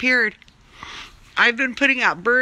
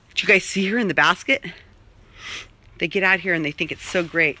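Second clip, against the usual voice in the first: usual speaking voice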